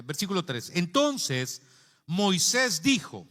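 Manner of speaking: loud, high, emphatic pulpit delivery; forceful preaching. Real, not acted. Preacher expounds the burning bush to listeners, forceful preaching. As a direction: drive each phrase home like a preacher.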